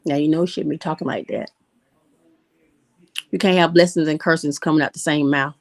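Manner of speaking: a hint of a disapproving church mom; mom's scolding voice. Remembered mom scolding her daughter for cursing, lightly voiced. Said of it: "my mom's voice in the back of my head".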